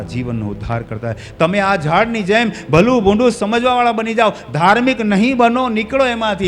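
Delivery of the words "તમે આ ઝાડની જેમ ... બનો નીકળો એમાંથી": high, loud, urgent preaching; full-force exhortation. Real, not acted. Preacher urges congregation out of mere religion, full-force exhortation. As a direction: exhort them forcefully, stretching the final words.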